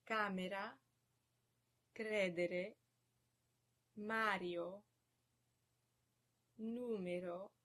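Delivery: camera, credere, Mario, numero, each stressed on the first syllable; early stress. Shown as a demonstration. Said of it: "stress on the third to last syllable".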